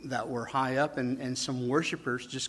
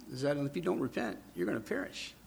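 ordinary delivery

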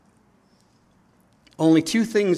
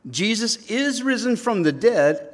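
"is" punched high in a raised, louder voice; emphatic IS; 10 semitones higher, 6 dB louder than usual. Shown as a demonstration. Preacher declaring the resurrection a fact, emphatic stress on "is". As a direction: proclaim it, driving home the word "is". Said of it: "It's emphatic in the Greek".

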